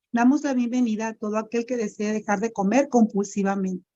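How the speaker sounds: usual speaking voice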